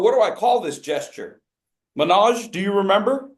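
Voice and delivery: usual speaking voice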